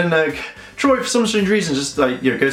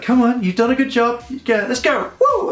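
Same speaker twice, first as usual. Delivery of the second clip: higher-pitched mock pep talk ending in a woo; imitated cheering on; 10 semitones higher than usual. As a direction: egg the kid on, rising to a high whoop. Voicing Ranger urging kid on.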